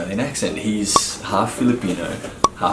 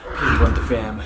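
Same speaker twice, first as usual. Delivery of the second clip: ordinary talk, nothing marked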